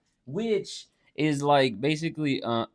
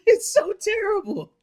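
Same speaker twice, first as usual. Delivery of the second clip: high, slow, loud through laughter; helpless laughter; 6 dB louder than usual. Real, not acted. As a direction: wail it while cracking up. Cracking up at friend's bad accent.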